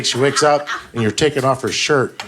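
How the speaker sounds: ordinary talk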